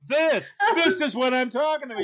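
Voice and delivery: high, exasperated mock outburst; exasperated retort. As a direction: act out an indignant comeback. Retold quarrel, she snaps back.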